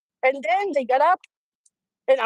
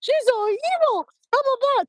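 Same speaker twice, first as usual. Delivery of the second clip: imitating sulky daughters crying; whiny crying kids; 11 semitones higher than usual. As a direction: daughters whining about mom in a high mock sob. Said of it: "doing this screaming and crying".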